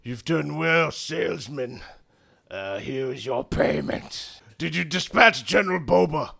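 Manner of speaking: lightly voiced goblin commander; goblin general. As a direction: put on a hint of a villainous general. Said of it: "and the general says".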